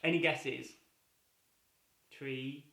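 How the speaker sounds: ordinary talk, nothing marked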